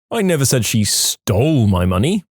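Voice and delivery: "stole" stretched and punched, rising end; stress on STOLE. Shown as a demonstration. Testing a phrase's meanings, stress on "stole". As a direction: lean hard on the word "stole". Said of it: "depending on which word you stress".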